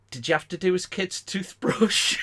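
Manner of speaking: high, put-on voice asking about the toothbrush; Dave's high indignant question. Telling the story of the prank, voicing Dave's high outraged question. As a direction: pitch it up like an outraged friend. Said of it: "He went".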